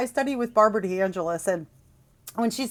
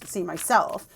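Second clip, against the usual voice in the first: ordinary talk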